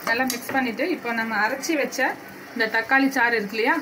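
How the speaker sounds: ordinary talk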